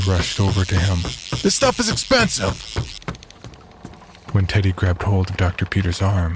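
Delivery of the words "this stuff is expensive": much higher, urgent shout; alarmed shout. Acted. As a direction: cry out to save the gear. Teddy rushes over alarmed.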